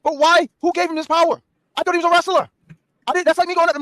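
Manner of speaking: worked-up rant. Real, not acted. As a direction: rant in disbelief, pitch way up. Heated rant about Rey's power.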